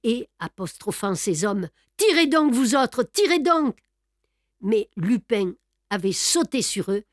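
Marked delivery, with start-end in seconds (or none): 2.0-3.8 high urgent command, louder; frantic call to fire; shouted order. A narrator's reading. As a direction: bark the order to shoot. Sholmès orders his men to fire.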